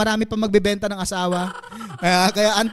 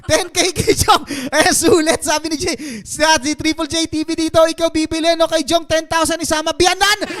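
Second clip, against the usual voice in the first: auctioneer; 5 semitones higher than usual. Pretend. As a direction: call the bids like an auctioneer. Mock auction continues.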